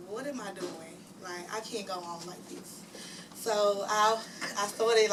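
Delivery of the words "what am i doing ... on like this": quiet despair. Retold or imitated. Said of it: "it was just like".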